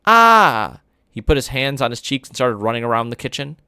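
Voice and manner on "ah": mock scream. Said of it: "It was like an".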